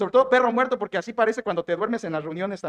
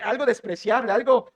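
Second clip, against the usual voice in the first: ordinary talk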